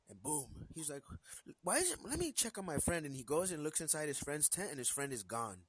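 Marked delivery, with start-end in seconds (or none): 1.6-3.0 high startled voice for the camper. Acted. Camper wakes to find friend gone, startled high voice. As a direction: jump up high, puzzled and startled.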